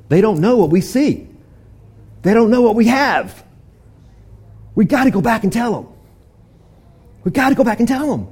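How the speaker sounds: pressing the others to hurry back; urgent excited voice; excited urging. Acted. Lepers urging each other.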